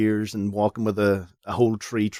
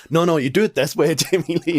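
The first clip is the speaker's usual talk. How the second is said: higher, louder mock demonstration; hammed-up instructing, pitched up; director showing actress; 7 semitones higher, 5 dB louder than usual. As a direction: play an eager director correcting the star. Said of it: "like, showing her".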